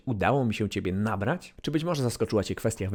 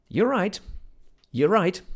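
higher, louder British "You're right"; British greeting; 7 semitones higher, 4 dB louder, slower than usual. Shown as a demonstration. Teacher demoing the British "You're all right" greeting in British accent. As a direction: greet like a Londoner. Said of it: "kwestia bardzo brytyjska".

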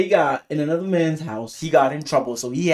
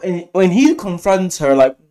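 usual speaking voice

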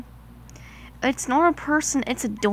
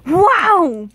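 loud, bright exclamation; amazed; 4 semitones higher, 8 dB louder than usual. Acted. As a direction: burst out in delight. Duncan tastes the donut.

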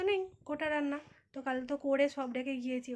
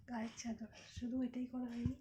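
whisper; 7 dB quieter than usual. Real, not acted. Mother whispering to viewers while the baby sleeps. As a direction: whisper it under your breath. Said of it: "আস্তে আস্তে কথা বলছি".

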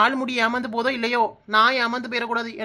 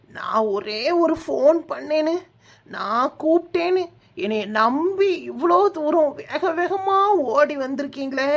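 slow, drawn-out mock-emotional lament; tearful; 2 semitones higher, slower than usual. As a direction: stretch each phrase like a melodramatic weepy thank-you. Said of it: "அத நினைச்சுதான்க்கா அழுறேன்". (அழுகையோடு இழுத்துப் புலம்புவது)

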